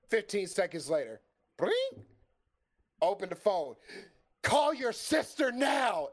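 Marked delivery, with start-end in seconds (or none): 4.4-6.1 urgent call. Retold or imitated. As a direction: read the wife's text like a shout. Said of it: "It's Missus Yalcha".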